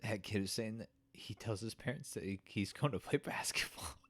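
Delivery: soft, halting, genuinely choked up; barely getting the words out through tears; fighting tears. Real, not acted. Explaining the kid who has been shot, tearful. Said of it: "expecting to cry during that".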